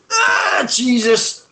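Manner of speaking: sudden high yelp of alarm; startled shout. Real, not acted. Jumps and yelps at something in the room. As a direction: cry out, caught off guard.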